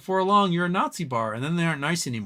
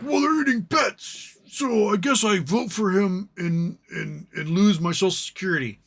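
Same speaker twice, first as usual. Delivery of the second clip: mock gullible voter; 6 semitones higher, 4 dB louder than usual. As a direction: play a clueless voter talking themselves into it. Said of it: "They're like".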